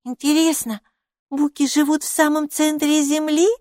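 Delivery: high, curious question; high-pitched inquisitive creature; wondering little character. A narrator's reading. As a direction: muse aloud in a high little voice. Maika wonders aloud.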